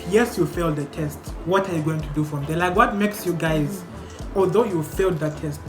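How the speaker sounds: normal talking voice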